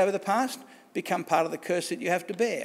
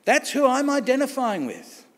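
Jesus declaring; 6 semitones higher than usual. Retold or imitated. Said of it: "Jesus is saying".

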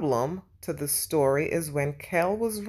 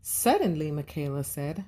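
plain reading voice

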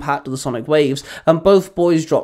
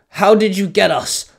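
a mild bark, higher in pitch; barked demand; 5 semitones higher, 4 dB louder than usual. A narrator's reading. Captive hero confronts the villain, lightly barking. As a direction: fire the question out, slightly raised voice. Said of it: "Hawkeye barked".

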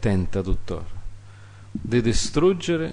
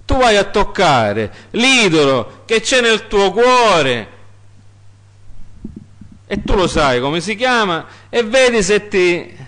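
full-force preaching; 10 semitones higher, 5 dB louder than usual. Acted. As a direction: cry the verse to God at full voice. Preacher challenges listeners. (predicazione gridata, alta e lenta)

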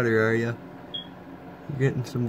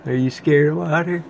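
teasing pet talk; 4 semitones higher, 7 dB louder than usual. Real, not acted. Teasing pet about water.

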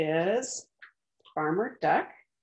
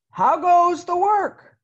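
higher, louder, slow farmer's call; 11 semitones higher, 12 dB louder, slower than usual. Acted. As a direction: call out lazily from bed. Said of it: "called the farmer".

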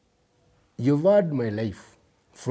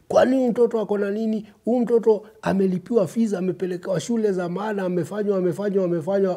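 higher, quick-fire chorus of scolding onlookers; disapproving society voice; 7 semitones higher, much faster than usual. Acted. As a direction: rattle off the complaint at a raised pitch. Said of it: "we will all say".

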